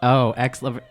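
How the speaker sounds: usual speaking voice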